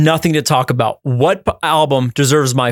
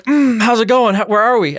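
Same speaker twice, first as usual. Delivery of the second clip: lightly acted waking-up voice, higher pitched; just waking up; 7 semitones higher than usual. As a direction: come to from a nap, confused. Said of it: "then you wake up and you're like".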